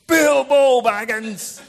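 slow, loud, high booming wizard; Gandalf booming. Retold or imitated. Gandalf rebukes Bilbo over the ring, slow booming. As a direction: draw the name out like an offended wizard. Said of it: "And Gandalf said".

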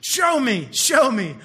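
loud, punchy, repeated demand; hammed-up insistent cry; impatient demand. Eliza demanding proof of love.

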